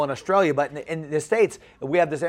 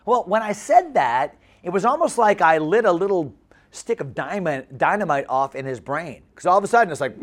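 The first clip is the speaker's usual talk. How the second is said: normal talking voice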